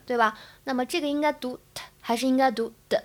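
ordinary talk

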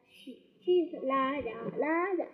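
usual speaking voice

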